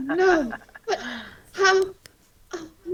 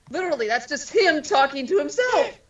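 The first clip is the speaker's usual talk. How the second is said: ordinary talk, nothing marked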